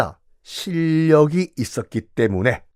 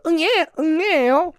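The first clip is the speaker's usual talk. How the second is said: much higher, mock newborn wail; baby cry; 13 semitones higher, slower than usual. A newborn prince crying, mock baby voice. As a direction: squeak out like a newborn.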